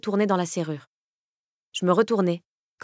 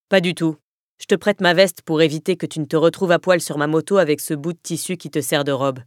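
Kaz's lower voice, pushed louder, dismissive; louder gruff retort; 3 semitones lower, 5 dB louder than usual. Acted. Kaz brushing off her teasing about his jacket, gruff and louder. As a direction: bark back the refusal, gruff.

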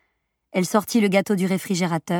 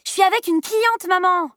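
very high, protesting retort; sharp retort; 11 semitones higher than usual. A narrator's reading. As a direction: call back in protest to your mother. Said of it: "rétorqua Jane". (réplique agacée, très haut perchée)

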